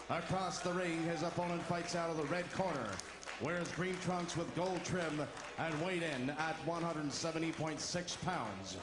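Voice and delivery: ordinary talk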